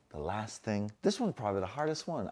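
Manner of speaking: usual speaking voice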